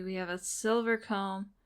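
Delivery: ordinary talk, nothing marked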